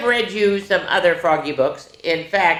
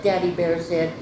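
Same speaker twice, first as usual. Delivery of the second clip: ordinary delivery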